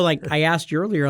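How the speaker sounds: ordinary talk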